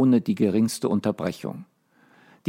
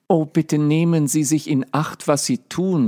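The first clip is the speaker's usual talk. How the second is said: put-on Alice calls out to the cook in fear for the baby; anxious cry; 6 semitones higher than usual. A narrator's reading. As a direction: call out in alarm. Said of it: "rief Alice".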